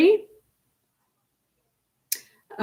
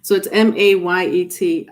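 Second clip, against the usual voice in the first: usual speaking voice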